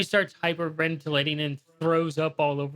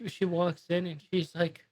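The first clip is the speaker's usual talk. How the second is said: ordinary talk, nothing marked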